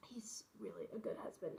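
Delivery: soft whispered aside; whispering. Real, not acted. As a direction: whisper it fondly. Whispered aside about husband.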